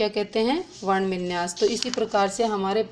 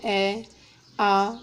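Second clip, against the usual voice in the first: usual speaking voice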